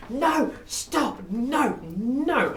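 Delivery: high, shrill-pitched mock scream of an old lady; old woman at a wedding screaming for the band to stop. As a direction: squeal it like a panicked grandmother. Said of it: "comes screaming up".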